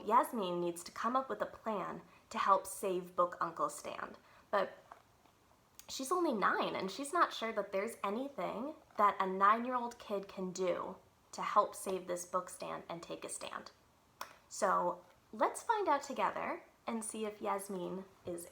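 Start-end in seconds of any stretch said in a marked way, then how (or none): none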